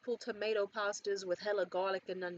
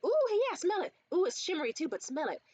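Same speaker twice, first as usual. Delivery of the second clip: high, louder eager pitch that settles by the end; excited saleslady; 8 semitones higher, 4 dB louder than usual. Retold or imitated. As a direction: sell it, cooing and eager. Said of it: "the way I was like".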